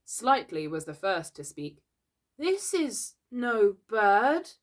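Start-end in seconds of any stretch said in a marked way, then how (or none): 2.4-4.5 slow, high, fearful realisation; scared voice. A narrator's reading. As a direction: say it slowly, afraid of the truth. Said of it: "he said in a scared voice".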